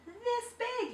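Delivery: high excited cry; cried out. A narrator's reading. Frog showing the parrot, excited high cry. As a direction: fling it out high. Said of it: "he cried".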